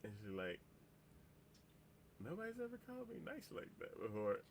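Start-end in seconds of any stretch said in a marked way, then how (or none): 2.2-4.4 tiny high voice, very soft; imitating a dazed girl in a small, high voice; frazzled, stunned girl. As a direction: squeak it out softly, as if dazed. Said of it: "kinda frazzled look".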